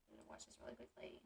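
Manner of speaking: quick whispered aside to yourself; fast whispered note to self. Real, not acted. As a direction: murmur it under your breath, fast. Aside to self before playing clip.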